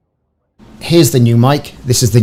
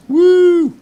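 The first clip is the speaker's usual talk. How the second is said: excited whoop; 6 dB louder than usual. Real, not acted. Celebrating mic working.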